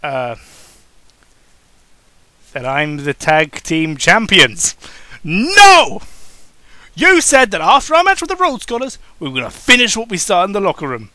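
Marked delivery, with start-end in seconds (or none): 5.2-11.1 much higher, loud protest then rushing on; hammed-up exasperated partner; indignant no. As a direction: shoot back high and loud, then race the reminder. Brian corrects his partner.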